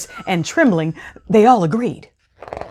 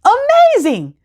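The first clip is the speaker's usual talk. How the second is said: much higher, louder exclamation; exclaiming; 4 dB louder than usual. A narrator's reading. Puss flatters the sorcerer, excited exclamation. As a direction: burst out in mock amazement. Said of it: "Puss exclaimed".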